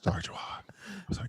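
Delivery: whispered sorry. Real, not acted. Host softly apologizing to a scared chihuahua, whisper. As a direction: soothe the dog in a hush. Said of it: "whispered apology to the dog".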